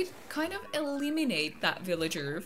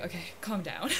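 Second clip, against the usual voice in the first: ordinary delivery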